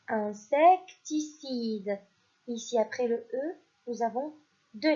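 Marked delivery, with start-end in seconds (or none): 0.1-2.0 "insecticide" said very slowly, syllable by syllable; slow dictation. Shown as a demonstration. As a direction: stretch out "insecticide" so children can spell it. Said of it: "Quatrième mot".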